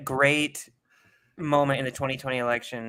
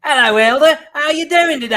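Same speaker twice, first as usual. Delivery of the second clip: loud, high Australian greeting; hammed-up Australian accent; mock Australian accent; 12 semitones higher, 13 dB louder than usual. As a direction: boom it out in broad Australian. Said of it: "you'll definitely have an Australian accent".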